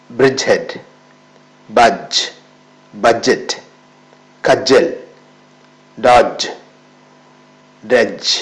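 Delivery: each word with a silent d, spoken clearly and firmly; teacher modelling words one by one with the d silent; model pronunciation. Shown as a demonstration. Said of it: "ഞാൻ ആ വാക്കുകളൊക്കെ pronounce ചെയ്യാം". (ഡി silent ആക്കി വ്യക്തമായി ഉച്ചരിക്കുന്നു)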